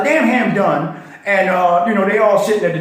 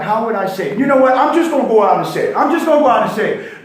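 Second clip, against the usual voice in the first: ordinary talk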